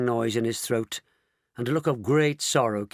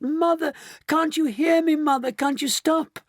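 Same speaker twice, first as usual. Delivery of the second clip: high, louder desperate plea; wailing; 4 dB louder than usual. A narrator's reading. Daughter calling to her shrinking mother, wailing. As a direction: plead in a high-pitched wail. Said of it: "wailed Missus Bucket".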